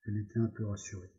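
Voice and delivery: usual speaking voice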